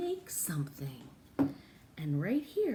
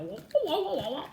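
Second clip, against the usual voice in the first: mock crying wah; 9 semitones higher, 6 dB louder, faster than usual. Imitating paper holes crying.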